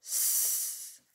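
drawn-out "S", a pure sssss; hissed S sound. Shown as a demonstration. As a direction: sustain "S" as air only, sss. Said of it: "il chantera encore". (« S » sifflé longuement : sssss)

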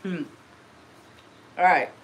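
ordinary talk

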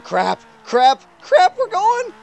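rising panic, ending very high; panicked. Retold or imitated. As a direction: voice shoots up in alarm. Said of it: "It's like".

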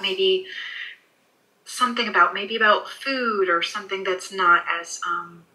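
usual speaking voice

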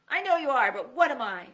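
loud, high mocking comeback; schoolyard taunt. A narrator's reading. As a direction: throw the old playground line back. Sam taunts the knight.

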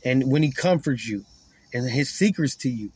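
ordinary talk, nothing marked

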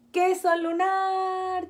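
loud, slow, stretched-out cheer; shouted cheer. Acted. Family shouting for the moon photo, loud slow cheer. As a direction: everyone yells it for the photo. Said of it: "Todos gritan".

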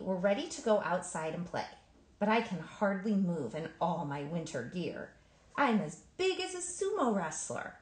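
plain reading voice

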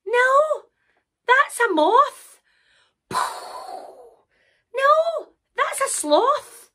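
high, slow, insistent protest; exasperated Sarah. Acted. Sarah rejecting one wrong animal after another, exasperated. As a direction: stretch out the animal's name, high-pitched.

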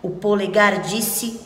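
plain reading voice

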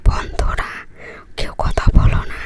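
hushed whisper, barely voiced. Real, not acted. Whispering to viewers so the parrots stay put, hushed whisper. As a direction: whisper so the birds won't fly away. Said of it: "এখন আস্তে আস্তে কথা বলতেছি".

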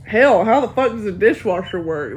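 ordinary talk

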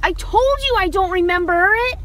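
ordinary talk

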